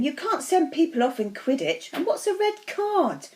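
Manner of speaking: high, incredulous question; object, ending baffled and pitched right up; bemused correction, climbing high; puzzled objection. A narrator's reading. Puzzled friend corrects a football fan.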